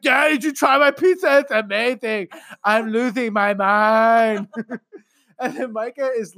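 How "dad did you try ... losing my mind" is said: put-on high, excited kid gushing. Son raving to dad about pizza, high and excited. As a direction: gush like a thrilled child. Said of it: "He's like".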